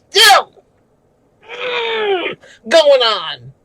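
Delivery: real cry of rage, high and loud; furious outburst. Real, not acted. Streamer ranting about the girl's death, real furious yell. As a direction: break into an angry yell. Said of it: "I'm screaming".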